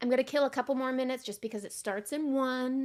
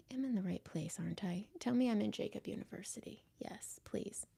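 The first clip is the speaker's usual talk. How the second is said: softer, half-whispered plea; anxious hush; 8 dB quieter than usual. Real, not acted. Host asking viewers to confirm she is in the right place, half-whispered. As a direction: whisper it hoping someone confirms.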